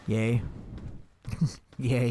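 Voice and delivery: flat sarcastic yay with a laugh. Acted. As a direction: cheer with no joy at all. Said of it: "he said super sarcastically".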